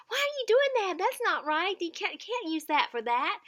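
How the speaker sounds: high, loud scolding disbelief; shaming scold. Shown as a demonstration. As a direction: scold them like a naughty child. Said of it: "never shame them saying".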